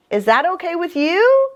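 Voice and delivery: ordinary delivery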